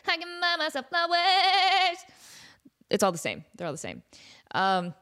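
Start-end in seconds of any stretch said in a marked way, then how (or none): 0.1-2.0 a slow, higher hint of [name]; sing-speak it like Miley, lightly. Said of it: "Or [name]".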